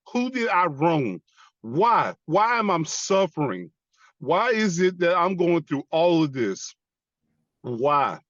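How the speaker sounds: high, pleading demands; anguished questioning. Retold or imitated. Veteran asking God why he suffers, anguished demand. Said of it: "I asked God".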